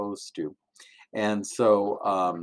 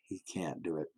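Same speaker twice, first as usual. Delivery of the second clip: hushed whispered repeat of "He can't do it."; 5 semitones lower, 12 dB quieter, faster than usual. Acted. Preacher whispers the son's failure to the children. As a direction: drop to a sad whisper on "He can't do it.".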